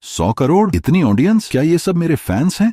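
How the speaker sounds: high-pitched incredulous questions; stunned amazement. A narrator's reading. Otaku streamer seeing his sudden fortune, amazed and high-pitched. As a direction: squeak each question in rising disbelief. Streamer discovers money and huge audience.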